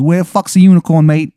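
loud, high mock English punk; unconvincing English accent. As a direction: announce yourself in hammed-up bad English. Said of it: "his accent isn't convincing at all".

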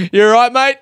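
high, loud worried call; concerned mate. Retold or imitated. Mate calling over, high and loud with worry. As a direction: check on your mate, worried. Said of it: "What a moment that is".

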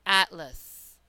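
atlas with a full first A and a reduced uh in the second syllable; careful teaching demo of atlas; demo pronunciation. Shown as a demonstration. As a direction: say atlas as AT-lus, the second A a quick uh. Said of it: "as in".

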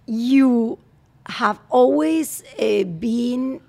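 slow, careful English; halting nervous English. Real, not acted. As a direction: search for each word nervously. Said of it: "me está poniendo nerviosa".

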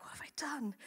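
hushed, breathless mock panic; a whispered little moment of dread; panicked whisper. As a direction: whisper it like you've just made a terrible mistake. Panicked self-talk after inviting someone.

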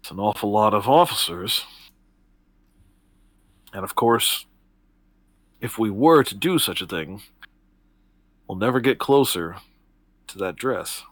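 lightly voiced cool schemer; Octavia, measured. Octavia warns the party off attacking the officers, a lightly voiced calm schemer.

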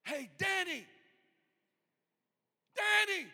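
high, loud call by name. Acted. The Lord calling someone who tunes him out, hollering. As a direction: holler to get someone's attention. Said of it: "How many times does the Lord say".

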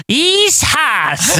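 high-pitched mock holler; Dusty Rhodes. Co-host imitates the masked rider by the fire, hammed-up holler. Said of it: "Dusty went".

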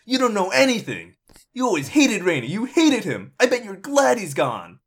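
put-on high, loud teenage screaming at stepmother; angry son yelling at his stepmother; furious shout. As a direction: scream it like a grieving rebel lashing out. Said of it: "his eyes are blazing with anger".

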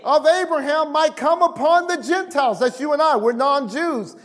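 high-pitched, driving sermon delivery; impassioned preaching. Real, not acted. Preacher declaring redemption from the curse to his congregation, impassioned preaching. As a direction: preach it with rising fervor.

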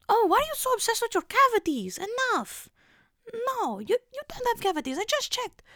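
high, put-on Mediterranean accent, dismissive; mimicking her Mediterranean dentist scolding her. Imitating her Mediterranean dentist brushing off the patient, high Mediterranean accent. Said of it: "Mediterranean or something".

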